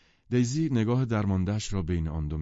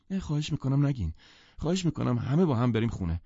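higher-pitched, imploring interruption; helpless pleading; 6 semitones higher than usual. A narrator's reading. Daisy begging everyone to go home, helpless plea. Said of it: "عاجزانه دخالت کرد".

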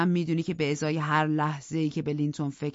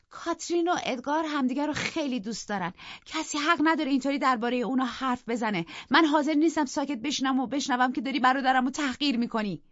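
put-on high, louder young woman's voice cutting in; indignant sister defending her brother; loud outburst; 10 semitones higher, 3 dB louder than usual. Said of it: "با صدای بلند".

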